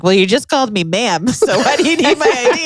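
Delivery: laughing retort. Retold or imitated. Mocking the clerk who asked for ID, laughing. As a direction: tease him through a laugh. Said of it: "And I was like".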